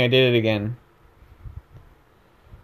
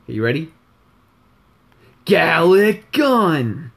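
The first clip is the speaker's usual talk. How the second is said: drawn-out, higher attack-name yell; Rice; 6 semitones higher, 6 dB louder, slower than usual. Shown as a demonstration. Gamer demonstrating how his character calls the move, slow loud yell. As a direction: yell it slowly and loudly as a battle move. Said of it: "here's how Rice would say Gallic Gun".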